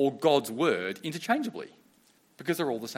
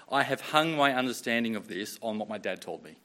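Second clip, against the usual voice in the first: ordinary talk, nothing marked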